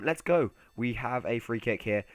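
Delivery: ordinary talk, nothing marked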